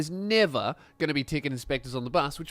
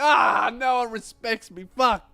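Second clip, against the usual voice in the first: slow, louder moaning complaint; self-pitying grumble; 5 semitones higher, 4 dB louder, slower than usual. Acted. Bus driver sulking on his day off, slow loud moan. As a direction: moan it like nobody cares.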